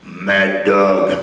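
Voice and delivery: low growled nickname; deep rough growl naming him; gravelly Mad Dog. Retold or imitated.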